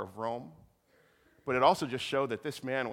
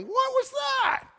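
startled; 7 dB louder, much slower than usual. Retold or imitated. Congregation reacts to sudden trouble.

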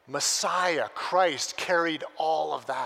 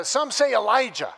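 disciple pipes up; 6 semitones higher, 9 dB louder than usual. Retold or imitated. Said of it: "pipes up".

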